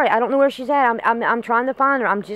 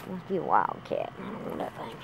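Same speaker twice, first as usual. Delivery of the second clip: muttered aside; 8 semitones lower, 13 dB quieter than usual. Acted. Muttering about wild cat.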